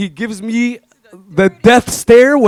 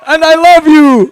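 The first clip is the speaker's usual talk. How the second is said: excited; 6 semitones higher, 6 dB louder than usual. Real, not acted. Joking defiant speech at wedding.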